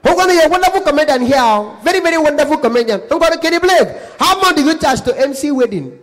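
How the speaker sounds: high, loud gushing caller; effusive Anambra caller. Acted. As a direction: pile on praise in a hammed-up caller voice. Anambra caller flatters and asks fee.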